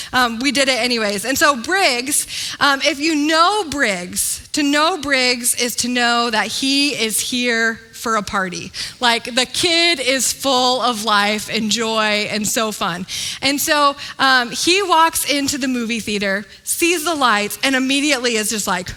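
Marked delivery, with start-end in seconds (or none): none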